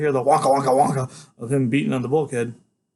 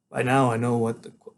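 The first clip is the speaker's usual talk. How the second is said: usual speaking voice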